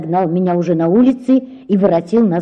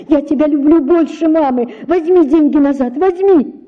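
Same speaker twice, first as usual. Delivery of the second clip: desperate plea; 7 semitones higher, 4 dB louder than usual. Acted. Girl begging, crying after father.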